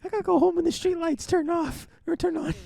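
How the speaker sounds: put-on very high child voice; nine-year-old action hero. Co-host as the child star with a curfew, squeaky kid. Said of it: "Little nine-year-old".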